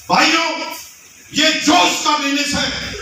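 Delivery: hammed-up seminar speaker shouting about josh; mock hype shout. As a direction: pump up the crowd with a shouted slogan. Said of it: "चिल्ला इतना तेज रहा".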